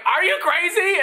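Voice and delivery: very high mock squeal of disbelief; hammed-up, high-pitched incredulity; incredulous. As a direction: imitate her utter disbelief, voice shooting way up. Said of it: "she just looked at me again like".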